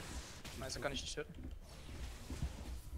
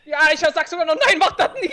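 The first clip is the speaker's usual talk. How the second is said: excited; 6 semitones higher, 8 dB louder than usual. Real, not acted. Hyped recap after the win.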